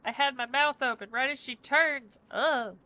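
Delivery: loud disgusted groan; disgusted exclaim. Real, not acted. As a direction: yell out in disgust. Disgusted by fur in mouth.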